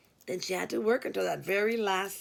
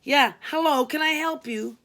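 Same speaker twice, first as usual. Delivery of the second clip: snappish, louder and impatient greeting; bitchy; 6 semitones higher, 6 dB louder than usual. Acted. Tired receptionist answering yet another call, bitchy and curt. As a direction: bite off the greeting, fed up. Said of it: "I answered quite bitchily".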